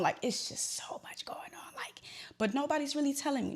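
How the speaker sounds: unvoiced whisper; whispering while crying on the curb; whispered despair. Real, not acted. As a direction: whisper it, drained.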